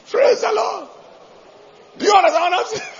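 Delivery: high, loud preacher call to the crowd; rallying shout. Real, not acted. Preacher rallying his congregation with a loud praise call. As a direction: fire up the congregation.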